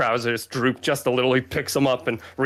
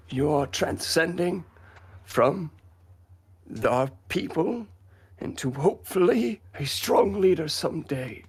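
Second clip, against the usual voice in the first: put-on higher, halting old man's voice; elderly grandfather; 6 semitones higher than usual. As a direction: speak slowly as an aging grandfather, with long pauses. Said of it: "the grandfather Glan kind of approaches him".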